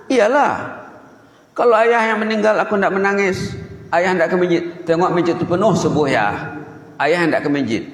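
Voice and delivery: high-pitched, emphatic acted retort; child's defiant insistence. Anak menegaskan lagi, menegur ayahnya. (anak membantah tegas, nada tinggi)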